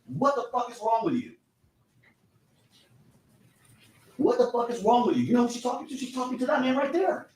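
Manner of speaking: high, quoted outcry at someone; high-pitched retelling of a scream; imitated scream. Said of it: "she first screams in really loud".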